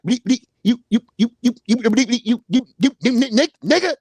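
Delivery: hammed-up stammering curse at the speaker; mock stuttering rant. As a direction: stutter out the cussing like him. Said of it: "He be like".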